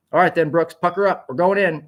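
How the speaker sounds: the doctor spurs Brooks on; exclaiming doctor. A narrator's reading. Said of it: "exclaimed the doctor".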